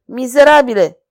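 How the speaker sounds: furious king cursing the jester; full of anger. Acted. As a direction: spit out the insult in rage. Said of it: "rosti regele plin de mânie".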